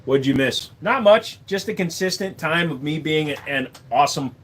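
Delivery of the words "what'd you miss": ordinary talk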